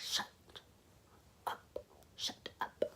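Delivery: low whispered shut up, slow and pressing; urgent whisper. Retold or imitated. Grabbing the friend's neck so the officer can't hear, in a whisper. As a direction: hiss it while grabbing his neck.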